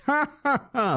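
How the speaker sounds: high-pitched put-on villain laugh; joyous mock laugh. Narrator imitating Arlong's joyous facade, put-on laugh. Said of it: "always maintain that joyous".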